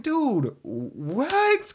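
high, stunned "Dude, what?"; disbelieving high-pitched retort; shocked what. Retold or imitated. Mailman reacting to the kid's comment, stunned. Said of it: "And I'm like".

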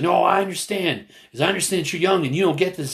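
high-pitched, insistent imitated arguing; retold heated rebuttal. Two protesters arguing, imitated heated insistence. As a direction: fire back as in a retold quarrel. Retold back-and-forth quarrel.

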